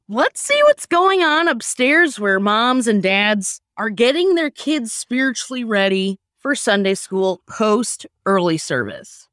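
ordinary talk

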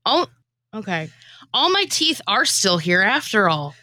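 normal talking voice

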